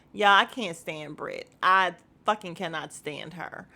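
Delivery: usual speaking voice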